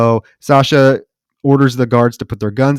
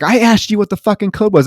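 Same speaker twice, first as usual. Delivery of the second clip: much higher, insistent demand; angry demand; 9 semitones higher, 3 dB louder than usual. Retold or imitated. Woman repeating her demand to the captor, higher and angry. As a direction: lose patience and demand it. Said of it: "she's like".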